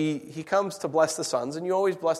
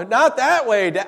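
raised, higher plea to an aging dad; mock protest to dad; 7 semitones higher, 8 dB louder than usual. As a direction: correct your father loudly and fondly. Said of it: "You know".

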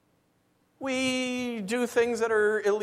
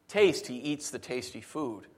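ordinary delivery

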